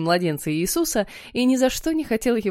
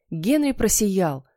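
ordinary delivery, nothing marked

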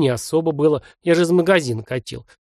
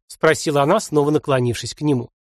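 plain reading voice

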